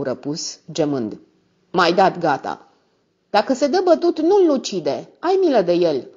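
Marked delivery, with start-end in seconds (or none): 1.7-6.1 high, urgent plea for mercy; pleading. A narrator's reading. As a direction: plead with rising pitch and a little more force. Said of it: "îl rugă gurista".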